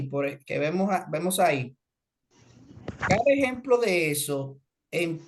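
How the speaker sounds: ordinary talk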